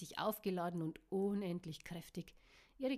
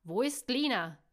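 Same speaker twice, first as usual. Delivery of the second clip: loud, raised demand; urgent shouted question; barked question; 4 semitones higher, 12 dB louder than usual. A narrator's reading. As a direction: bark it like an alarmed wolf. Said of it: "bellte Will".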